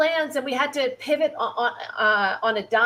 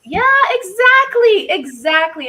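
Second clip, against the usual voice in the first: much higher and louder, drawn out; excited agreement; 9 semitones higher, 7 dB louder, slower than usual. Real, not acted. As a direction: jump in thrilled to agree. Agreeing eagerly with co-host.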